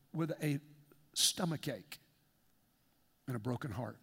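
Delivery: low, soft and grieving; heavy-hearted. Real, not acted. Pastor confessing grief to his congregation, low sorrowful. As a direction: let the sorrow sink the words.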